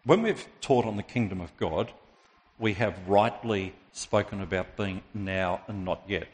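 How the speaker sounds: ordinary delivery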